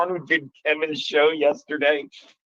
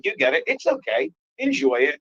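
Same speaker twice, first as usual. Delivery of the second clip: teasing reassurance; 9 semitones higher than usual. Retold or imitated. Pointing at laughing audience members.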